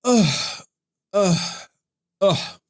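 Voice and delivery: high, strained ugh, ugh, ugh; frustrated groans. Real, not acted. Streamer venting at his chat, exasperated high groans.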